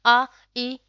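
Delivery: आ, ई sounded out clearly for the class; teacher modelling the vowels आ, ई; vowel demo. Shown as a demonstration. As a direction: model आ and ई with a flat unrounded mouth. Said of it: "इसके होंठ हिल भी रहे कि नहीं".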